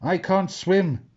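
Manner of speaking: high, slow mock wail; hammed-up wail of a scared pirate. A narrator's reading. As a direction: draw it out in storybook despair. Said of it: "wailed Grumpy Greg".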